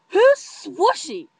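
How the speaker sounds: high, loud and drawn out; loud clueless question. Retold or imitated. Friend who doesn't know the nickname, loud blurted question. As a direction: blurt it out far too loudly. Friend asks too loudly.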